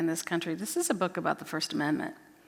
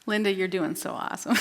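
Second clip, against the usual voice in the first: normal talking voice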